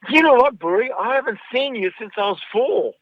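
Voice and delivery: put-on excited little girl racing up to the storyteller. Little girl greeting the storyteller, in an excited child's voice.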